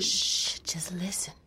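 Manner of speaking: softly whispered shush; whispered hush. Acted. Hushing the boy mid-song.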